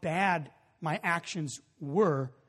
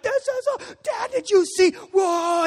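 high, loud imitation of a thrilled boy; squealing, breathless-with-joy child impression; pumped-up kid; 8 dB louder, slower than usual. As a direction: burst with excitement for your dad. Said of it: "he's so pumped for me".

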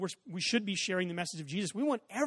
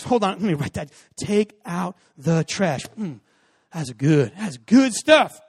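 louder, slow appreciative murmur; mock churchgoer; 11 dB louder, slower than usual. Son playing the admiring churchgoer to dad, slow and louder. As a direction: savor each word like a sermon note.

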